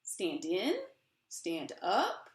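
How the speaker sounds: slow, swelling spoken-word performance of a poem; dramatic poem reading. Acted. Author reads her book to the audience, impassioned poem reading. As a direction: perform the poem with rising passion.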